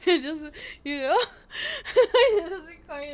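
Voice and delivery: words breaking into laughter; laughing. Real, not acted. Streamer failing to explain the math, cracking up. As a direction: try to talk through a laughing fit.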